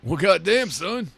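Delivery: a hammed-up Texan. Joking about wrestlers, hammed-up southern accent.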